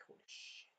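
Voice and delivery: whispered gasp of a curse; shocked whisper. Retold or imitated. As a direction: breathe it out stunned. Hero realizes betrayal.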